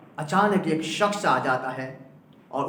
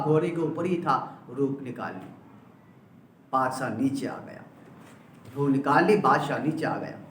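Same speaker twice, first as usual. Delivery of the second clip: ordinary talk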